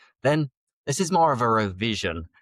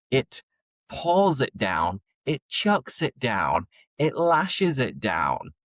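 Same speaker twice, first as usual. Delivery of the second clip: normal talking voice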